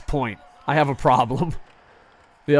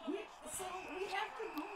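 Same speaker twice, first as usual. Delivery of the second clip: mocking voice; 20 dB quieter than usual. Mocking girlfriend's plan.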